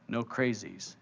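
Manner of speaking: low, hushed correction; inner divine voice. Acted. Divine voice correcting a doubter, low and quiet. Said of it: "The voice says".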